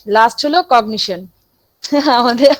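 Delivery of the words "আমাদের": real laugh breaking into the words. Real, not acted. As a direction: break up laughing while speaking. Breaks into laughter mid-lecture. (হাসতে হাসতে বলা)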